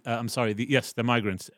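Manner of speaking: normal talking voice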